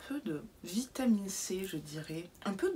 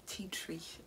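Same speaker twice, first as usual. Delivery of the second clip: soft whispered English words; whispered aside; 3 semitones lower, 10 dB quieter than usual. Acted. Vlogger whispering an English plant name.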